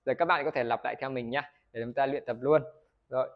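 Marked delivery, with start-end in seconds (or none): none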